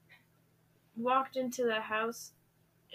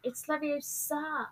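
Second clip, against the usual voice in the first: Hermione impression; 5 dB quieter than usual. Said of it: "Leviosa, not Leviosa".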